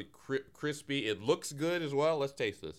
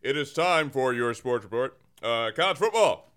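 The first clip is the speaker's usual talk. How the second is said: sports announcer. Acted. Bagman sports report segment.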